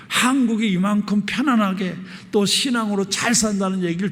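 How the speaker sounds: moved, emphatic preacher's retelling; retold heartfelt gratitude. Retells the pastor's emotional declaration.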